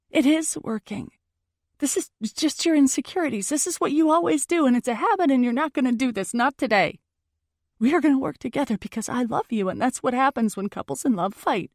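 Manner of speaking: fast, pressing argument pitched high; insistent pleading. Acted. Girlfriend insists to her boyfriend they will work together, urgent.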